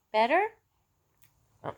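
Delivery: normal talking voice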